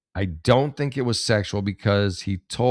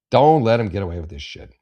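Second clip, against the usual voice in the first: louder, forceful insistence; emphatic urging; 6 dB louder than usual. Real, not acted. Host urging the victim to report, emphatic and loud. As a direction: drive it home with force.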